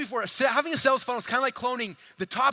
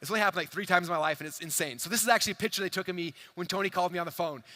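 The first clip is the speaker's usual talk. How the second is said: ordinary talk, nothing marked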